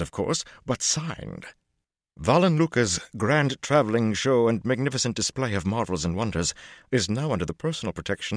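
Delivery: high, loud, gushing showman; Lucca's voice. A narrator's reading. Lucca gushing to Matt about the High Lady, excited. Lucca reads warrant, boasting.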